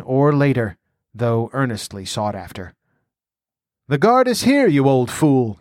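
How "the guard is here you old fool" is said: cavalierly snapped, pitched up. A narrator's reading. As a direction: cut the old fool off with a raised, impatient voice. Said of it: "cavalierly snapped Palantides".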